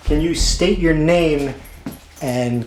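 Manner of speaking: loud, slow call across the set; loud director's call to start the take. Acted. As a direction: project it so the whole room hears.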